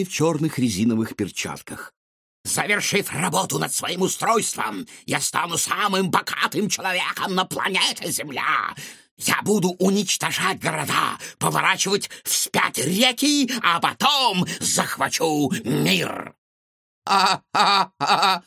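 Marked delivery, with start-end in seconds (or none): 2.4-16.3 high, grandiose villain rant; evil mad scientist. A narrator's reading. Villain boasts world domination. 17.1-18.4 loud rattling robot guffaw; robot laughing. A narrator's reading. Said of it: "затрясся и загромыхал".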